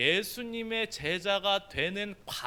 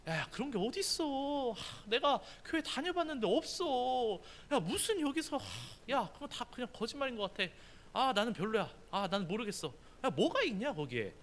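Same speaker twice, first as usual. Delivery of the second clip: bothered dismissal; 6 semitones higher, 3 dB quieter than usual. Retold or imitated. Nathanael brushing off Philip's invitation, bored and annoyed. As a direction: wave off the friend like he's a nuisance. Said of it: "귀찮다는 듯이".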